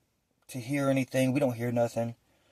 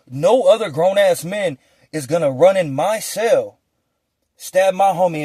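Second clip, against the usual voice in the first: louder, slow, heated defiance; defiant outburst; 4 semitones higher, 7 dB louder than usual. Retold or imitated. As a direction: lay down the law in your own cell. Furious defiance about cell.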